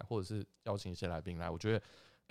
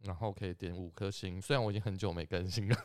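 ordinary talk

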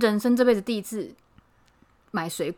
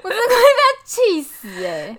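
laughing exasperation; 10 semitones higher, 9 dB louder, slower than usual. Real, not acted.

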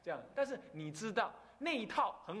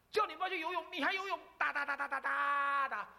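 imitating scolding mother; 9 semitones higher, 3 dB louder than usual. Mother scolding child in story.

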